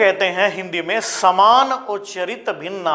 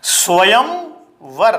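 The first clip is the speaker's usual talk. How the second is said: slow dictation; much slower than usual. Shown as a demonstration. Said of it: "तो देखो स्वयंवर".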